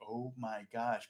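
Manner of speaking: soft, slow, awed; quietly stunned by the news; awed murmur to himself; hushed amazement. Retold or imitated. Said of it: "And I was like".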